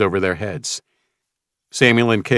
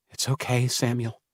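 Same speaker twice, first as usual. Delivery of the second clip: much softer, quiet reassurance; soft and brave; 4 semitones higher, 7 dB quieter than usual. Acted. Peace comforting Samuel while kneeling, soft reassurance. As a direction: comfort him gently. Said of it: "she said".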